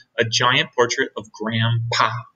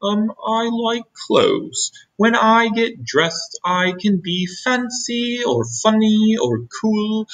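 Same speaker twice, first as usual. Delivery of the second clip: shy Wilbur; 9 semitones higher, slower than usual. Acted. Wilbur answers shyly.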